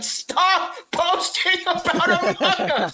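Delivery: high, slow mock scream; screaming car guy. Recalling the meme video of the angry car guy, mock screaming. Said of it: "that fucking video of the dude in his car".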